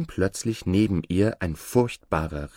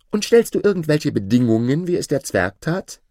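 loud, high-pitched questioning; calling out; 7 semitones higher, 6 dB louder than usual. A narrator's reading. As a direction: call the question up to a giant. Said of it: "griff nach der Lampe und rief". (laut und hoch fragend)

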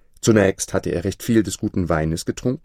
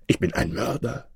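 hushed, shocked confession; shaken; 3 semitones higher, 4 dB quieter than usual. A narrator's reading. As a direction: let the horror sink in quietly. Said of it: "rief er erschüttert".

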